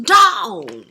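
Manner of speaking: ordinary delivery, nothing marked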